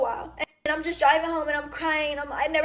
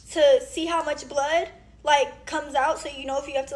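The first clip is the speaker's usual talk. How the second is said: ordinary talk, nothing marked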